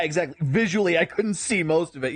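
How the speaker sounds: usual speaking voice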